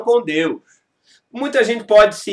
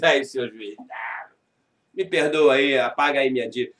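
pleading mock low voice; 9 semitones lower, 5 dB quieter than usual. Mocking plea to a judge.

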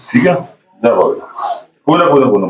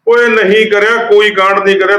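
higher, sharp protest against a false charge; indignant denial; 8 semitones higher than usual. Acted. Sarpanch snaps back at his wife's accusation, indignant. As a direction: push back hard, voice up. Wife accuses, husband denies hotly.

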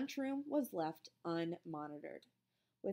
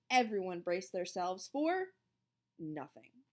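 ordinary delivery, nothing marked

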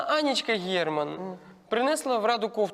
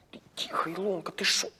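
urgent voiceless whisper; alarmed hush; 4 dB quieter than usual. Acted. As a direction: hush your friend before anyone overhears. Hushes friend about risky topic. (налякано, пошепки)

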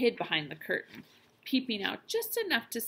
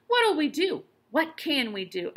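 helpless; 7 semitones higher, 7 dB louder than usual. A narrator's reading. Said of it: "asked the father helplessly".

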